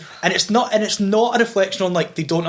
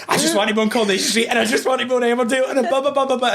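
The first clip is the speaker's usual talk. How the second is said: fast and high, gabbling; rushed young self; 6 semitones higher, faster than usual. Retold or imitated. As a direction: rattle it off like an eager kid. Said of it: "I remember being like".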